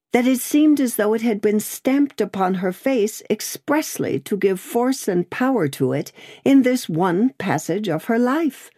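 plain reading voice

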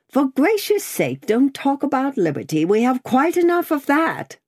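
high, exasperated scolding; exclaiming in a high character voice; Miss Pross. A narrator's reading. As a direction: protest indignantly, pitched right up.

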